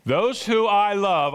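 loud, high, drawn-out proclamation; emphatic. Acted. Preacher voices Jesus to a wayward church, emphatic. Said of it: "the language is very emphatic".

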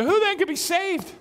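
astonished disciples asking each other; amazed disbelief. Retold or imitated. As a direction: gasp it out in disbelief. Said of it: "they said to each other".

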